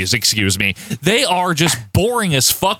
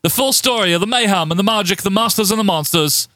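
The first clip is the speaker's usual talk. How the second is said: mock newsreader in a shaky New Zealand accent; badly faked New Zealand accent; 4 semitones higher than usual. Host quoting Butch in the newsroom, bad New Zealand accent. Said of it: "That's not a good accent".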